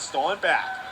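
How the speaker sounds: ordinary talk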